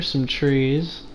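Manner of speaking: ordinary delivery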